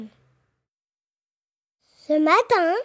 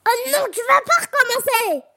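higher, fed-up groan of a wolf; wolf begging it to stop; exasperated wolf; 6 semitones higher than usual. Acted.

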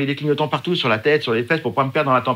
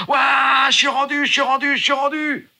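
triumphant shout; 11 semitones higher, slower than usual. Retold or imitated. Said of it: "Je fais".

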